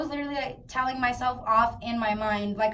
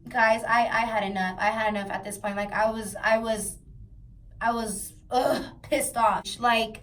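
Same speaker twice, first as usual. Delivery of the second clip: ordinary delivery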